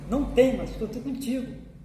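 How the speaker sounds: loud, high burst on the opening words; emphatic divine assurance. Acted. Preacher voices God telling the fearful not to fear, emphatic proclamation. Acts out God's reassurance.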